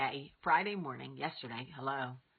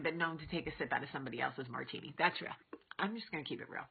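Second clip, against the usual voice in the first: ordinary talk, nothing marked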